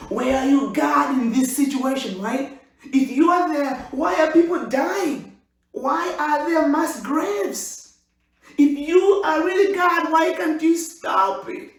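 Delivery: high, loud, pleading questions; loud high anguished cry to heaven; anguished questioning. Acted. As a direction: cry out at God in protest. Voicing doubters' questions at God.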